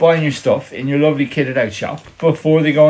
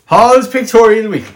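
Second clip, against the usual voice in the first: loud call-out; 6 semitones higher, 6 dB louder than usual. Pretend. Calling out TV show name.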